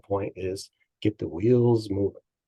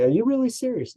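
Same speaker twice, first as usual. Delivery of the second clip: mock incredulous challenge; 13 semitones higher than usual. Coach challenging client, retold.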